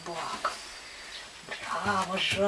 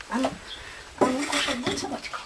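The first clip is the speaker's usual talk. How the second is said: squeaky praising a dog; 7 semitones higher, 4 dB louder, faster than usual. Real, not acted. Teasing the dog with food.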